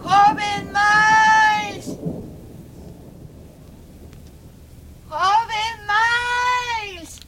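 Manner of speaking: high, long drawn-out distant call; calling from afar. Acted. Servant calls the young master outside, long shout. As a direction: call across the garden, stretching the name.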